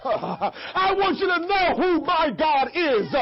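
ordinary talk

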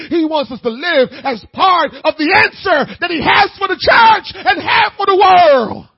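loud, high, shouting sermon; preaching at full force. Acted.

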